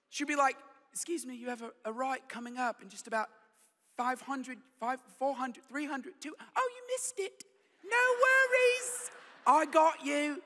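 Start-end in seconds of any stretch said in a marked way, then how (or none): 0.9-6.3 soft British GPS lady impression; mock-British satnav voice, softly. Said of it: "the voice of the British lady". 6.5-10.4 very high, drawn-out British singer impression; hammed-up British lady, sweetly forgiving. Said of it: "This is my [name] impersonation".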